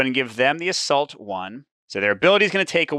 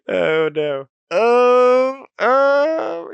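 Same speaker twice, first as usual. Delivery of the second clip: high, loud, drawn-out moans of dismay; comic crying wail; 13 semitones higher, 7 dB louder, much slower than usual. Real, not acted. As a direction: wail helplessly, half laughing. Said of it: "but I'm crying".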